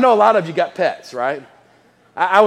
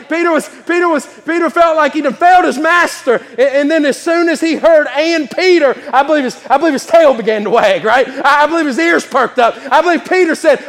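louder, higher, fervent preaching; preaching at full force; 6 semitones higher, 4 dB louder than usual. Acted. Preacher stirring the congregation about Peter, fervent full-force preaching. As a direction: build to a rousing climax.

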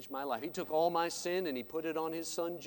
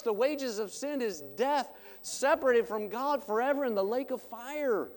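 higher, louder revival preaching; fiery preaching; 6 semitones higher, 5 dB louder than usual. Acted. Preacher warning the congregation about sin, fiery preaching.

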